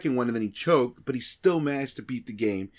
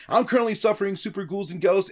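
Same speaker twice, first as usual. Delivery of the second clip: announcer raid intro; 7 semitones higher than usual. Shown as a demonstration. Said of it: "my raid intro".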